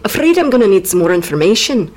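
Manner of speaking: put-on puzzled, amateur Scottish accent; Macbeth. Macbeth querying the witches' prophecy, mock Scottish accent. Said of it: "Macbeth's a bit like".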